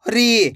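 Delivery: री with the ee held long; drawn-out vowel. Shown as a demonstration. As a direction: stretch the ee of री. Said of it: "खींच खींच के किया जाता है".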